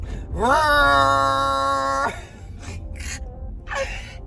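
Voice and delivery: a hammed-up engine-and-tire screech; car noise imitation. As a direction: mimic the Milwaukee cars screeching past. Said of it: "That's how the Milwaukee cars sound".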